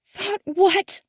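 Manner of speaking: high, sharp demand. A narrator's reading. Daughter demanding an answer, high and sharp. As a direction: snap back at him.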